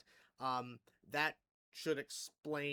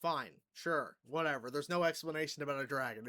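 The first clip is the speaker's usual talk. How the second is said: ordinary talk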